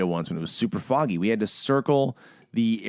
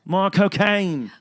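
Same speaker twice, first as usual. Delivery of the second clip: put-on slow, higher, louder Michael Caine accent, "mah cocain"; 9 semitones higher, 4 dB louder, much slower than usual. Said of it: "in Michael Caine's accent".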